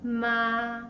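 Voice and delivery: "Ma" held long on a flat, level pitch; "Ma" sustained without any rise or fall; first tone. Shown as a demonstration. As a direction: say "Ma" in Mandarin first tone, perfectly level. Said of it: "The first tone".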